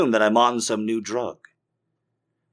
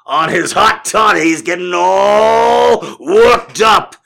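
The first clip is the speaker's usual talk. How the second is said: put-on loud, high old-timey scolding, words drawn out; outraged townsfolk; 8 semitones higher, 9 dB louder, slower than usual. Townsfolk gossiping about the hot toddy drinker, outraged shouting. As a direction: cry out like a scandalized crowd. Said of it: "Everyone's like".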